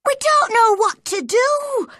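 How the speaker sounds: high, loud panicked wail; distressed cry. A narrator's reading. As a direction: cry out helplessly for help. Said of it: "she cried".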